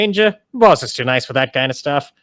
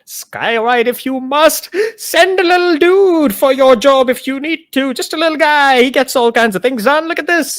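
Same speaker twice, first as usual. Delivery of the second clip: high, playful show-off voice; lich voice; 9 semitones higher than usual. Acted. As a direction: brag about your magic lightly. Lich shows off magic.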